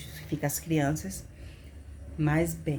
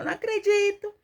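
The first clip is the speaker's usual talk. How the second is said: very high, held wail of disbelief; reenacted crying, pitched way up; tearful lament; 13 semitones higher, 3 dB louder than usual. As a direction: wail it out as an anguished plea. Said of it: "eu chorava".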